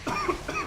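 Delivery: sharp choking cough. Real, not acted. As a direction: cough hard mid-game. Still choking on bug.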